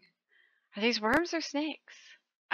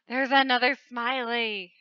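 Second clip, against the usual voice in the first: ordinary talk, nothing marked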